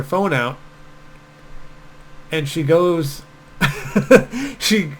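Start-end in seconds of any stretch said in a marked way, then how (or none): 3.6-4.5 mock laugh. Woman on beach laughs.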